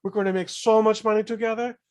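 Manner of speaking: high-voiced pleading manager impression; put-on high voice of a scheming manager; Colonel Tom Parker. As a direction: pitch it high, wheedling the star. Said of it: "he talks a little bit like that".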